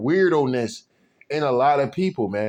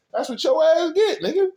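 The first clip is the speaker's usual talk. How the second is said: pitched way up, mocking the heartbroken guy; high emphatic jab; 11 semitones higher than usual. Real, not acted. Mocking a heartbroken man, he jabs up high. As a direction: throw it up high like a taunt.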